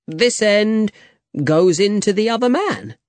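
high-pitched, breezy boast; cocky explanation. A narrator's reading. Young man shows Diego the sword, cocky and high. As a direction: explain it high and self-assured.

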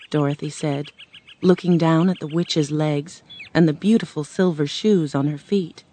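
ordinary delivery

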